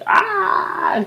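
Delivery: high mock baby cry; short imitated infant wail. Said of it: "just crying".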